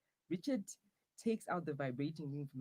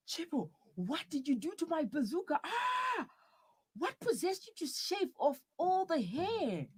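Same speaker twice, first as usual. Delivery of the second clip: high-pitched outraged scream; 12 semitones higher, 4 dB louder than usual. Acted. Partner discovers the shaved hair, screaming in horror. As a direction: shriek in horror, stretching the last words.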